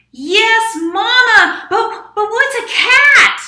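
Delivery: very high, very loud squeaky voice; loud mouse. Acted. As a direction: shout it like an eager little mouse. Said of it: "That loud mouse just could not use a quiet voice".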